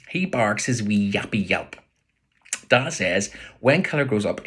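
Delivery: ordinary delivery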